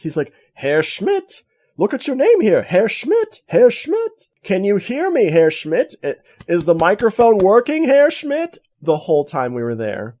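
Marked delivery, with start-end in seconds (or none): 0.5-8.6 put-on high, loud teasing old man calling out; mocking interviewee. Holocaust survivor teasing cameraman Schmidt, high and loud. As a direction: tease the cameraman by name, loudly. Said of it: "he went hilarious on us".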